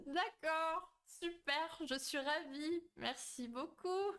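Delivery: high, laughing mock-delighted voice. Streamer answers viewers teasing her about bugs, mock delight.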